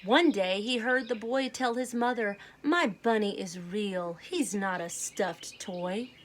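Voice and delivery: usual speaking voice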